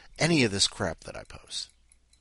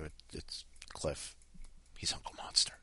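whispered confidential aside; hushed warning; 8 semitones lower, 13 dB quieter than usual. Pretend. Host warning newcomer Madra about Cliff, whispering. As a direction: lean in and whisper it like a secret.

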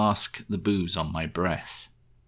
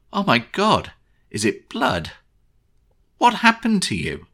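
alarmed wife; 11 semitones higher, 6 dB louder than usual. Acted. Wife alarmed at bloody husband.

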